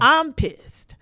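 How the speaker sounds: telling a friend how furious she got, loud and high; heated. Real, not acted. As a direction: spit out your anger. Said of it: "I'm heated now".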